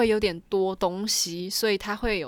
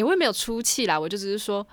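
normal talking voice

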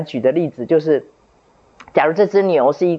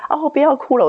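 put-on higher, gentle coaxing to a crying child; soothing teacher; 11 semitones higher than usual. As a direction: comfort her like a kind teacher. (老师高声温柔地哄哭泣的孩子)

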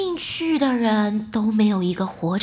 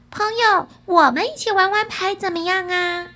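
higher, put-on talking cat; 6 semitones higher than usual. A narrator's reading. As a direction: pitch it up as a sly cat's invitation. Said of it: "两只黑猫对他说".

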